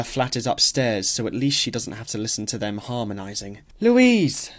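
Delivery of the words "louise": calling her name out, higher and louder; cheerful call. A narrator's reading. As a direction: hail her brightly across the garden. Neighbour calls out cheerfully.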